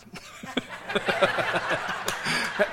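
long breathy laugh at own joke; laughter. Real, not acted. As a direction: break up laughing.

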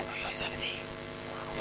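slow, barely audible whisper; imitating a girl's furtive whisper; hushed whisper. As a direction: whisper it discreetly across a room. Girl discreetly points out Bill.

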